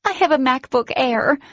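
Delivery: high, loud giddy shriek. A narrator's reading. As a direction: shriek it for the camera. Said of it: "Giddy, I hit record and shrieked".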